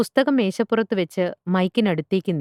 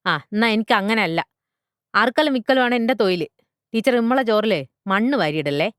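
raised pitch, louder, building protest; indignant butcher father; 6 semitones higher, 5 dB louder than usual. Acted. Butcher father defends his livelihood to teacher, indignant and rising. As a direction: argue hotly, climbing higher to the end.

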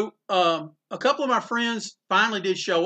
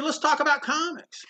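much higher, lightly voiced old man; imitating Eisner; 9 semitones higher than usual. Opening his hotel door to John, Eisner greets him in a lifted voice. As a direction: lift the voice way up in a warm greeting.

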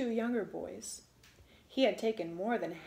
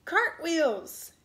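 loud order; 5 semitones higher, 5 dB louder than usual. A narrator's reading. Little man orders again.

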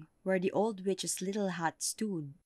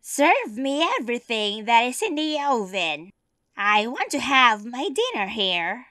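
witch voice; 5 semitones higher, 8 dB louder than usual. Acted. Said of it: "said to Vasilisa".

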